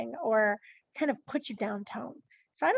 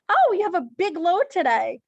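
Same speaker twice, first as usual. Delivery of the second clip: higher, louder mock-impressed remark; sarcastic; 5 semitones higher, 7 dB louder than usual. Shown as a demonstration. Cashier to a shopper with three items, sarcastic. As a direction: exaggerate being impressed, sarcastically. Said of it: "If that's sarcasm".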